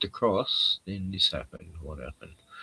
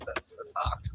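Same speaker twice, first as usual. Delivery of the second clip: whispering; 12 dB quieter than usual. Real, not acted.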